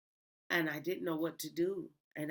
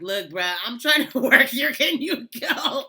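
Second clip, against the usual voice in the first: loud, high, laughing brush-off; laughing yell; 10 semitones higher, 15 dB louder than usual. Retold or imitated. Anybody telling the ghost to go, loud and laughing. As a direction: tell the ghost off through laughter. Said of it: "just anybody just telling the ghost".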